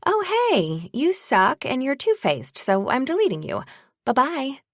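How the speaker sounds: high, sing-song fake friendliness; mock-cheery kiss-off. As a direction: chirp the insult sweetly. Imagined breezy kiss-off to false friends.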